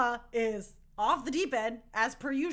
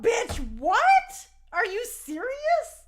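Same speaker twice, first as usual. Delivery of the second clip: much higher, loud shriek of disbelief; shocked disbelief; 11 semitones higher, 6 dB louder than usual. Retold or imitated. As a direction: friend voicing Katara's betrayed outrage. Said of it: "She was like".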